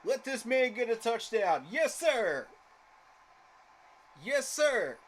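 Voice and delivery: loud, high hype for the player; excited cheering. Real, not acted. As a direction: fire up, celebrating every yard. Cheering the runner on.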